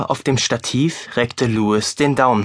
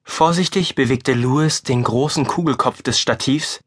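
ordinary delivery, nothing marked

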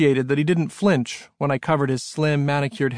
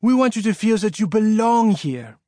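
put-on high, earnest French-accented welcome; mean every word, in a French accent; sincere, intense; 8 semitones higher than usual. A narrator's reading. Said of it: "He said it so sincerely, so intensely".

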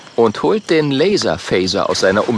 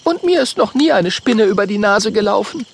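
higher voice, matter-of-fact; Mathilda's voice; 11 semitones higher than usual. Acted. Mathilda corrects Oskar about spider legs, higher voice. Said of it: "sagt Mathilda".

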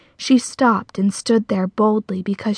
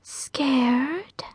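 high, soft whispery taunt; airy ghost-like spider whisper, rising into a question; eerie female spider voice; ghostly female spider; 4 semitones higher, 6 dB quieter than usual. A narrator's reading. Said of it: "The spider's voice sounded female and ghost-like".

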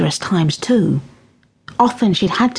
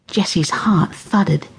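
ordinary delivery

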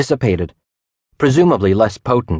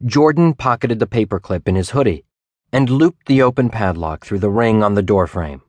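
plain reading voice

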